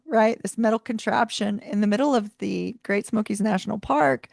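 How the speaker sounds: ordinary talk, nothing marked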